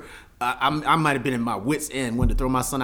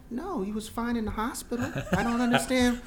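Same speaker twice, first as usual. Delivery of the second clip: high, soft mock-helpless voice; bewildered protest; 7 semitones higher, 5 dB quieter than usual. Imitating new dad answering the helpline, meekly baffled. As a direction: plead innocence in a small high voice.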